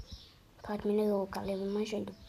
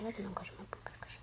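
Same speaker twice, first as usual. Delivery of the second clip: softer and softer until a whisper; fading whisper; 15 dB quieter, much faster than usual. Real, not acted. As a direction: let it die away into a whisper. Phrase sinks into whisper.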